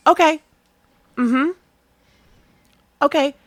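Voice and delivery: high, light okays to show she's still on the line; lilting okays; bright phone okays. Retold or imitated. Manager keeps the line alive for a ranting caller, with high, light okays. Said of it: "just to let her know I'm still on the phone".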